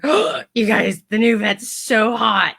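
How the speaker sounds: gushing. Retold or imitated. Speaker tells her team about hot vet, gushing. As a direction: imitate breaking juicy news, drawn out. Retold excited call to team.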